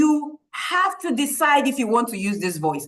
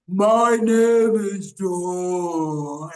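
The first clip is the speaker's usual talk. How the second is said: low, very slow superhero introduction; 5 semitones lower, much slower than usual. Pretend. Coach voices a child in the class, as a superhero. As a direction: draw every word out like a mighty hero. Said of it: "Somebody is saying".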